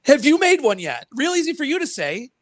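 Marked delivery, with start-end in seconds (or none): 0.1-1.1 higher and louder pointed question; louder repeat. Real, not acted. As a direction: push the question harder. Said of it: "repeats question louder".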